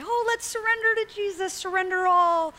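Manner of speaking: lightly hammed-up high preacher voice; mock preacher. Mocking how pastors call the church to surrender, hammed-up. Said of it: "pastors get up and they say".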